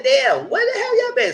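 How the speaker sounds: high-pitched, loud disbelief; mock incredulous outburst. Reviewer reacting to late disclaimer, loudly incredulous. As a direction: exclaim it, voice leaping way up.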